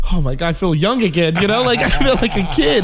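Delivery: giddy mom. Retold or imitated. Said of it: "My mom's like".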